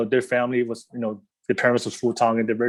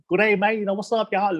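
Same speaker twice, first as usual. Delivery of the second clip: high, hammed-up Australian "good day mate" voice; 9 semitones higher than usual. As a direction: parody an Aussie surfer, pitched way up.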